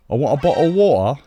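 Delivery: a bad American, hammed up; American accent. Attempting an American accent for chat. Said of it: "American accent".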